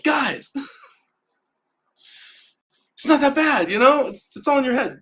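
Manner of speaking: chirpy pep talk. Retold or imitated. To everyone miserable around her, a high mock-cheerful pep talk. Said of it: "And I just wanna be like".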